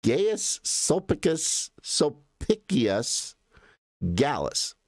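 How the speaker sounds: slow, drawn-out "Gaius Sulpicus, Sulpicius Gallus"; careful name pronunciation. Shown as a demonstration. Going over the crater's namesake for viewers, careful slow pronunciation of "Gaius Sulpicus, Sulpicius Gallus". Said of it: "let's just go over the name".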